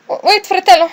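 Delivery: frightened little brother's stammered plea; stammering plea. Acted. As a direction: beg him to wait, stumbling. Character pleads as brother leaves.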